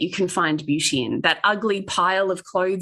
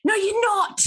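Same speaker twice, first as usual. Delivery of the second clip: high, laughing disbelief; mock barista; slower than usual. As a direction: refuse to believe her, chuckling. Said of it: "And he goes".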